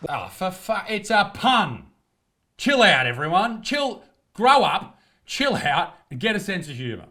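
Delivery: loud, high bursts of exasperation; exasperated outburst. Real, not acted. Telling offended critics it's a pun, exasperated. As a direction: tell them off, punching the short commands.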